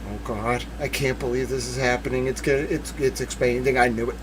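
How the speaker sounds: soft, stunned murmur under the breath; awed hush. Real, not acted. Streamer to chat, stunned and hushed. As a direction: hush it like you can't believe it. Shocked reading the filing.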